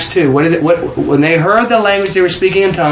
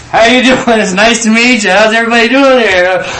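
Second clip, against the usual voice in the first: high, raised-voice hello; animated retold greeting; 5 semitones higher, 4 dB louder than usual. Storyteller replaying an introduction to an older couple, high animated greeting. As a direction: greet them in a high, lifted voice.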